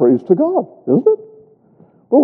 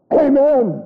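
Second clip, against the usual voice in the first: joyful amen; 9 semitones higher than usual. Acted. Doxology ending, exclaimed amen.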